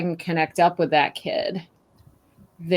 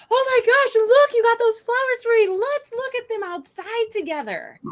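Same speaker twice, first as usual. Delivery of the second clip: much higher, gushing delighted parent; match the kid's thrill, then steer them outside; matching excitement; 3 dB louder than usual. Shown as a demonstration. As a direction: parent meeting a muddy kid's joy, gushing and high. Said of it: "Matching the energy could sound like".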